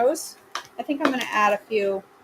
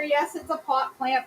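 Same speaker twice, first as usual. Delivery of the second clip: usual speaking voice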